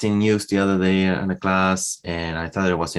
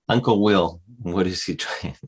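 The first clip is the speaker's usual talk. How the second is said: ordinary talk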